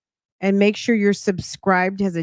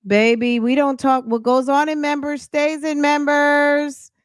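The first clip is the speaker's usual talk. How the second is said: sassy; 8 semitones higher than usual. Real, not acted. Teasing members rule.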